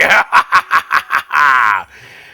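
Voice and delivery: big, loud, drawn-out cackle; hammed-up cackle. Host signing off to a Georgetown caller, loud hammed-up cackle. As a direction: let out a long theatrical laugh at full volume.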